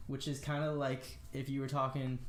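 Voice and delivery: ordinary talk, nothing marked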